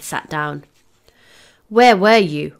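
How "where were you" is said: a touch of anger, louder and higher; understated furious demand. A narrator's reading. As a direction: demand it crossly, voice raised. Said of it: "asked in a furious whisper".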